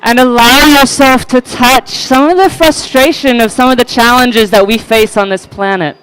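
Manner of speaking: loud, muffled behind both hands; hands over mouth. Real, not acted. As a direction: shout through cupped hands over mouth. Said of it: "put my hands over my mouth".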